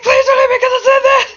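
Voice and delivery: very high, loud, fast squealed plea; panicked scream. Acted. Wolf screams in his head after confessing, frantic squeal. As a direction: scream it all out in one rush. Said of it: "he's literally just screaming".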